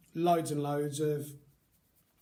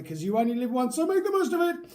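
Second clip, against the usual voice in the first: excited; 8 semitones higher, 6 dB louder, faster than usual. Real, not acted. Rousing sign-off to viewers.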